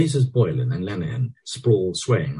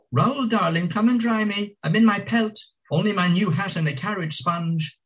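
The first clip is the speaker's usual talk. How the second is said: high coquettish lady voice; flirtatious woman. A narrator's reading. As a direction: coo it up high. Marion calling from bath.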